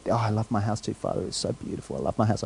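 soft, dreamy, nearly whispered adoration; mock doting whisper. Mock doting over own house.

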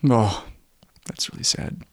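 whispered, very soft; softly sorry for the mom; sympathetic whisper. Real, not acted. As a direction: hush it with sympathy. Sympathy for crying wife.

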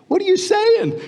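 high-pitched, incredulous question; acted disbelief. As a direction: play a listener taken aback. Voicing the indignant listeners in Athens.

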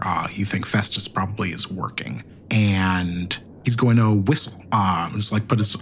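ordinary talk, nothing marked

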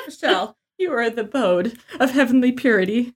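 hammed-up sentimental praise; over-the-top weepy compliment; mock tearful tribute. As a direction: gush it like a tearful toast.

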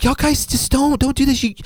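high imitation of a pleading friend; exaggerated peacemaker.

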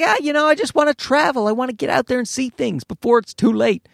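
lightly voiced dreamy friend; dreamy wanderlust. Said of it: "she's like".

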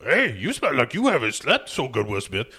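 hammed-up orc voice with swooping pitch; Nick the Orc. Orc partner talking to his cop partner in the car, put-on creature voice. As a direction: play a sing-song movie orc. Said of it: "Nick the Orc tells Will Smith".

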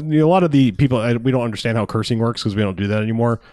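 ordinary talk

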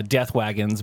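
ordinary delivery

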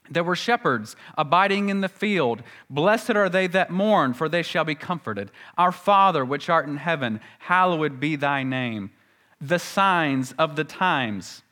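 measured, reverent King James recital; scripture reading. Acted. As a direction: intone the old Bible phrases with reverence. Quotes scripture phrases.

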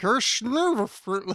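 Sean Connery impression. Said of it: "how to do a Sean Connery impression".

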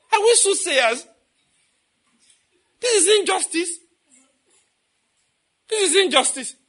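high, loud whining protest; mock complaining. Mocking believers who complain like exiles in Babylon, high loud whining. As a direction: whine as a wronged exile crying injustice. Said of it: "We'll start complaining".

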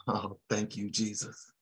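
soft murmured aside to Jesus; murmured prayer. Real, not acted. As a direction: drop to a near-hush prayer under the breath. Murmured prayer aside mid-sermon.

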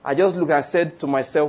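usual speaking voice

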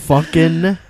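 normal talking voice

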